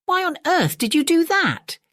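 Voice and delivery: high-pitched incredulous question; utter amazement. A narrator's reading. Gilda asks the manservant Magnus why, utterly amazed. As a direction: ask it amazed, voice leaping right up. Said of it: "in utter amazement".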